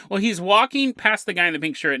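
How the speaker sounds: ordinary delivery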